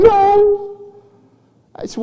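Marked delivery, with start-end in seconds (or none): 0.0-0.8 put-on very high, loud child's wail; hammed-up squealed protest; Chloe. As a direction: imitate a bawling little girl's denial. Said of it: "and she's crying".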